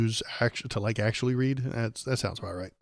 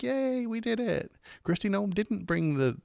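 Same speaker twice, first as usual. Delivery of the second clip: mocking cheering Democrats; 11 semitones higher, 3 dB quieter than usual. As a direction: gush like clueless fans of a hollow win. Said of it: "the Dems can be like".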